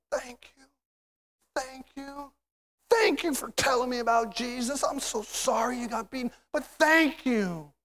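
high thanks, hushed then swelling; overcome with gratitude. Acted. Believers thanking beaten Paul and Silas, overcome with gratitude. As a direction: start small and high, build to heartfelt thanks.

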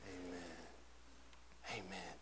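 a soft reverent murmur; whispered amen; hushed amen. Acted. Hushed congregation response.

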